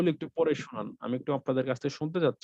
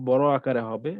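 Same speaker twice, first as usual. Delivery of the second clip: ordinary talk, nothing marked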